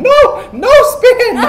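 high, loud, drawn-out mock plea; slow, high-pitched cry in mock alarm; imitated panicked dancer. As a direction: mimic someone frantically begging for a stop. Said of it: "she didn't speak any English, and she was trying to get her to stop".

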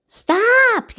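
very high, loud yell. A narrator's reading. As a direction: bark one sharp word to halt everyone. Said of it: "yelled Hippo".

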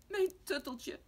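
put-on very high, loud childish whine; tearful wail. Tommy cries again for his lost tutteltje, tearful wail. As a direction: cry out like an upset little boy. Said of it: "huilt Tommy weer".

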